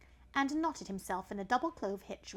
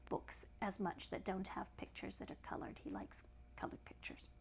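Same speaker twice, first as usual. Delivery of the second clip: soft unvoiced whisper; whispered aside; 11 dB quieter than usual. Real, not acted. Whispering to the child about Samson. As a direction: drop to a whisper for the listener.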